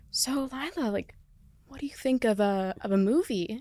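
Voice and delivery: soft, hesitant producer voice; tentative pitch, imitated. Producers sounding out the actor about a movie, soft tentative mimicry. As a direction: float the idea softly, with pauses. Producers cautiously floating an idea.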